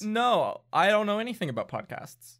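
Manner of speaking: lightly voiced hesitant toff; reluctant gentleman. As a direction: humour the orphan grudgingly. Gentleman answering orphan.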